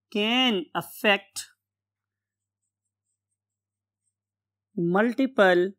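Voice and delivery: word-by-word dictation with long pauses; slow dictation. Real, not acted. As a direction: dictate slowly as if writing it on the board. Dictates a definition while writing.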